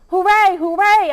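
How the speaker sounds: high, loud overjoyed cheer; joyful bark. A narrator's reading. Dog greets Hattie at the gate, overjoyed loud cheer. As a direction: burst out cheering like a thrilled puppy. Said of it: "I bark".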